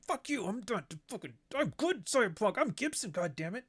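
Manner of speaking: high, whiny mock outrage as a hurt cyberpunk writer; squeaky fake tantrum; mock wounded author. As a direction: ham up a butthurt author insisting he's the real deal. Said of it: "like, LARPing, "I'm gonna be a cyberpunk author".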